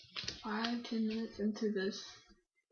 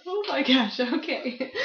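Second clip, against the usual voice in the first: laughing; 3 semitones higher, 7 dB louder than usual. Real, not acted. Reacting to request for prayer.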